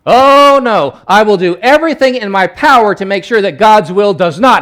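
loud, high, hammed-up protest; Peter. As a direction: cry out in exaggerated defiance. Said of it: "he pipes up again and he basically says this".

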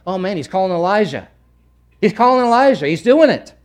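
building to a loud, high excited cry; amazed bystander, raising the voice; excited onlooker. Acted. Onlookers' excited reaction acted out.

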